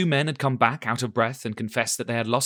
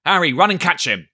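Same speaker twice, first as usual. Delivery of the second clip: ordering cry; 5 semitones higher, 7 dB louder than usual. A narrator's reading. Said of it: "cried Silver".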